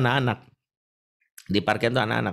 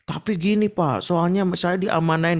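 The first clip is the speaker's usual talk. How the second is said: a lightly voiced defensive guard, pitched up; imitating the guard; 7 semitones higher than usual. Guard defends himself to the preacher, lightly voiced.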